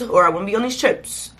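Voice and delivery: ordinary talk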